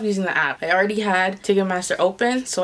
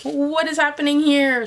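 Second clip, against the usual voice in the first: panicked disbelief; 7 semitones higher than usual. Retold or imitated. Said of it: "So I was like".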